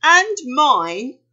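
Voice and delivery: high, loud moan. A narrator's reading. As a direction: whine it as a joint complaint. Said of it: "moaned Thumper".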